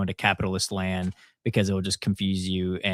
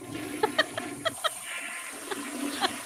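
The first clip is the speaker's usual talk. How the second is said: high, breathy whooshing flush; toilet flush sound effect; 9 dB quieter than usual. Pretend. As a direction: make a long flushing noise.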